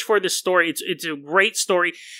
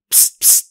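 spray sound. Pretend. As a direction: mouth hiss of a spray. Robocop mist spray sound.

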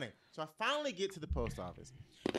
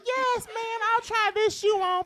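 high, loud, gushing customer voice; mock sweet shopper; 11 dB louder than usual. To the Gucci saleslady, high and gushing. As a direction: play the eager shoe shopper, pitched way up.

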